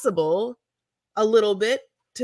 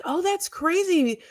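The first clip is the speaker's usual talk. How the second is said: clueless official; 8 semitones higher, 4 dB louder than usual. Retold or imitated. Clueless regulators voiced.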